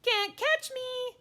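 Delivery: very high, slow, loud taunt; drawn-out high little-kid yell; hollering toddler. Acted. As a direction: holler a teasing dare like a small child. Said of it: "he hollered".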